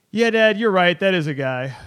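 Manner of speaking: loud, high-pitched mock agreement with Dad; loud child quote. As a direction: imitate a kid announcing it loudly, starting high. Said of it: "he loudly said".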